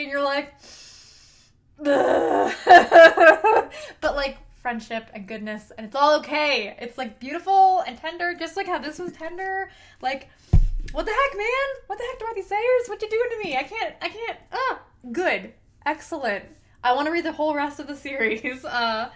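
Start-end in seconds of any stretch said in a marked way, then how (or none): 1.9-2.5 loud, hammed-up wail of "ugh"; mock anguished groan. Acting reader hit by tragic backstory. 10.9-14.8 high-pitched, playful protest; mock outraged complaint. Mock complaint to author over heartbreak.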